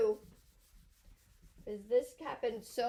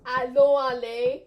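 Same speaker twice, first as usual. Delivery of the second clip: loud wrong example; 8 dB louder, slower than usual. Retold or imitated. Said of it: "you might say".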